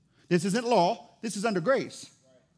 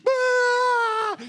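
loud, very high mock crying; imitated child's wail; 13 semitones higher, 5 dB louder than usual. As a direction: bawl over dad's scolding. Imitating hurt child's cry.

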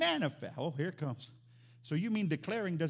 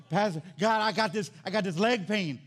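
mock complaining; 5 dB louder than usual. Voices a complaining congregant to God.

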